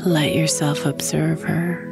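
ordinary talk

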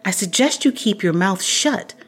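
loud, high, sarcastic. Acted. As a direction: throw his word back. Sarcastic retort.

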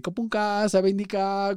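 lightly voiced Kopunka sabendica as a polite tourist; Thai greeting attempt. Shown as a demonstration. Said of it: "a una tienda en Tailandia y decir".

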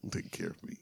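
breathy whispered repeat. Shown as a demonstration. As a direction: whisper it to yourself. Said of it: "repeated as whisper".

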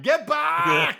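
high, loud action-hero shout; Arnie-style yell. Retold or imitated. Showing what super Arnie would be, hammed-up yell. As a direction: bark it like an over-the-top action hero. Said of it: "It's not like".

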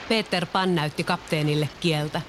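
ordinary delivery

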